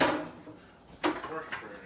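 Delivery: slow, whispered frustration; frustrated whisper. Real, not acted. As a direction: breathe it out, worn out. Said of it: "I'm really frustrated".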